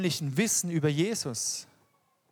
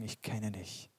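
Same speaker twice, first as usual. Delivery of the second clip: hushed assurance; 6 semitones lower, 12 dB quieter than usual. Retold or imitated. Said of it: "und sagen".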